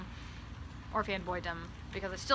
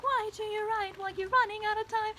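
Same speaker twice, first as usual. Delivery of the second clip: high fangirl voice; 12 semitones higher than usual. Retold or imitated. Quoting Hamilton line.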